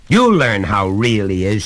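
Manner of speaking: loud angry threat; father's shout. A narrator's reading. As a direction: shout it after the boy. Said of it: "shouted his father".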